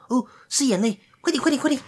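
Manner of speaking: high, hurried little fairy; tiny tinkling fairy voice. Acted. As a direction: squeak it out in a rush. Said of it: "急急忙忙跑走的小精灵".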